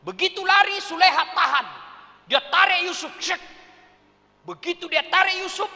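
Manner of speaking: high, loud, excited telling; excited narration. A narrator's reading. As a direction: tell the chase breathlessly loud. (penceritaan bersemangat dan keras)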